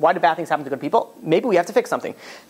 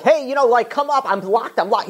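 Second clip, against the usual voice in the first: mild mock yell; half-voiced shout to people far below; retold screaming; 6 semitones higher than usual. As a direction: act out calling down for help, higher-pitched at first. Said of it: "starts screaming at people downstairs".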